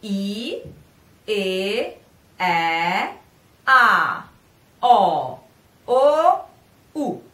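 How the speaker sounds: I E E A O O U one by one, clear; Italian vowels. Shown as a demonstration. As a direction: sing each Italian vowel. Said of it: "let's pronounce them all together".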